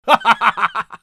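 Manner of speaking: short, rolling chuckle of amazement; delighted laugh. Acted. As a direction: laugh with joy at the miracle. Delighted at sudden recovery. (惊喜地哈哈笑)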